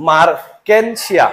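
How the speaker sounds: মার্কেনশিয়া said slowly, each syllable clear; slow careful pronunciation. Shown as a demonstration. Teacher modelling a name for students, slow and careful. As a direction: spell out মার্কেনশিয়া slowly for notes. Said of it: "উচ্চারণগুলা".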